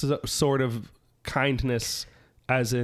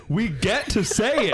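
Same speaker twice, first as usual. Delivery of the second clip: slow, higher, hammed-up glee; mock excited; 7 semitones higher than usual. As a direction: ham up the delight. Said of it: "And I said".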